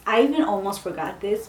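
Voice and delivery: usual speaking voice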